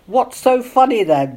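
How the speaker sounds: complain, a little put out; grumbled. A narrator's reading. Jonathan asks the laughing women, mild grumble. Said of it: "grumbled Jonathan".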